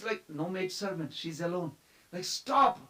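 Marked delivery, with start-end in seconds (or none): 2.2-2.8 high, loud acted command; urgent call. Mother chasing her child through the rooms, urgent call. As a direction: call out to halt a runaway child.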